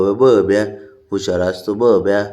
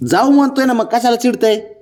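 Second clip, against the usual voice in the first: high, louder exasperated pleading; much higher, begging him to come; pleading coax; 12 semitones higher, 3 dB louder than usual. Real, not acted. Father pleading with sulking son, high and exasperated.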